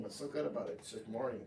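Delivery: low, soft, grumpy retort; surly grump. Acted. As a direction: grumble it back under your breath. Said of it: "always got that weird answer".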